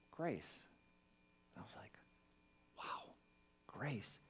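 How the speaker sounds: hushed, near-silent wonder; awed hush. Retold or imitated. Struck by the Spirit's answer, hushed awe. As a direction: breathe the word grace in awe.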